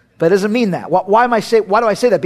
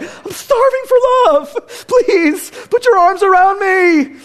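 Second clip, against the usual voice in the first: high-pitched, hammed-up tearful plea; mock desperate pleading; 9 semitones higher than usual. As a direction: wail for affection, comically needy. Imitates kids starved of affection.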